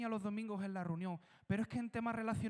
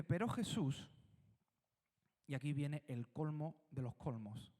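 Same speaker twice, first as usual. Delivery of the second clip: usual speaking voice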